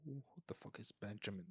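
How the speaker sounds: unvoiced puzzled whisper; confused whisper in the cinema. Real, not acted. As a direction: whisper the question under the breath. Whispering during the film.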